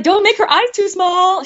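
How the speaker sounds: high, playful warning; half-laughing cheeky request; joking plea. Retold or imitated. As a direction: tease the director with a mock plea. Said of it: "I was like".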